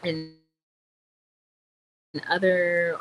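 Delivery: normal talking voice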